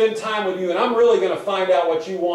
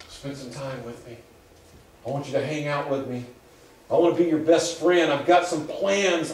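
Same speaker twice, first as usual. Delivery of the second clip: gentle voice of God; 4 semitones lower than usual. Acted. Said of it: "You hear that voice of the Lord".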